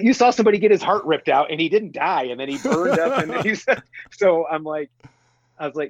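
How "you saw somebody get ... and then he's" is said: high, quick, incredulous listing; exasperated disbelief. Acted. Fan reminding Indy of the heart ripped out, exasperated. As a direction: pile up the proof in mock exasperation.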